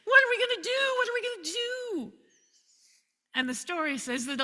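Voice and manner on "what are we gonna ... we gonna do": high-pitched, loud panicked squeal; panicked attendant. Acted. Attendant panics at the surrounding army, high frantic squeal. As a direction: shriek it out in sheer panic, far higher. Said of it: "and he's panicked".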